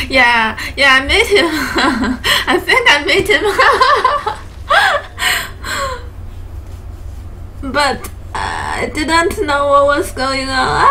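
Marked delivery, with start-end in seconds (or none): 3.5-7.7 high, wheezing laugh fit; helpless laughter. Real, not acted. Friend laughing helplessly about meeting Mitch, high and breathless. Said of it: "friend laughing helplessly". 7.7-10.9 words squeezed out through laughter; laughing while talking. Real, not acted. Friend admitting he didn't know, talking through laughter.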